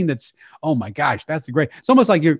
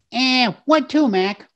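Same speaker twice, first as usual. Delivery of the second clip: high, nasal-free Brooklyn cabbie bark, pitched up; Brooklyn cab driver; 9 semitones higher, slower than usual. Retold or imitated. A pitched-up Brooklyn cabbie quizzing his passenger. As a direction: play a pushy Brooklyn cabbie. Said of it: "and the cab driver says".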